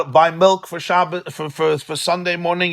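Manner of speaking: usual speaking voice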